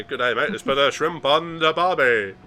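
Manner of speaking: loud, high, slow mock Australian accent; movie Australian accent. Quoting Dumb and Dumber to friends in a loud slow mock Australian accent. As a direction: quote the movie in a big fake Aussie voice. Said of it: "makes me think of Dumb and Dumber".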